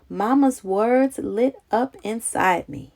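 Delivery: ordinary delivery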